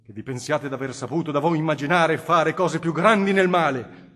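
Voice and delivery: cardinal pleading. Acted. Cardinal Federigo urges the Innominato toward repentance, impassioned. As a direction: preach mercy to a hardened sinner with growing fire. Cardinal's long plea to sinner.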